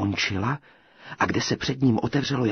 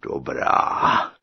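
giant; 6 dB louder than usual. A narrator's reading. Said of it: "odvětil obr".

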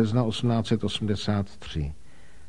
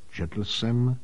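plain reading voice

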